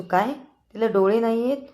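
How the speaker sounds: high, loud, astonished question; shocked question. Acted. Uncle shocked to hear the girl is blind, high astonished cry. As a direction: gasp it out in disbelief.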